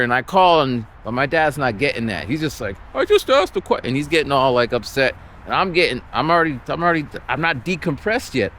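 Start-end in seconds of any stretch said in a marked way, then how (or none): 2.9-3.8 much higher, whiny protest; hammed-up hurt father; mock defensive dad. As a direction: play dad feeling wronged.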